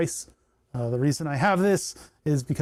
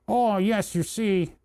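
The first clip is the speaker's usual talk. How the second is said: nasal, higher nerdy voice, talking through the adenoids; nerd voice; 5 semitones higher, slower than usual. Shown as a demonstration. Streamer slipping into his nerd voice, nasal and higher. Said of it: "the nerd voice is coming out".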